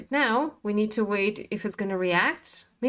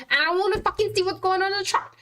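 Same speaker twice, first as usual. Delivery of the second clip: frustrated yell; 9 semitones higher, much faster than usual. Real, not acted. Fed up streamer yelling at the losing chart, frustrated yell. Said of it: "I was screaming".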